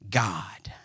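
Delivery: breathy whispered reveal of the word; dramatic whisper. Acted. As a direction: whisper it like a sacred secret. Dramatic reading of scripture.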